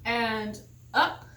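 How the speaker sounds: normal talking voice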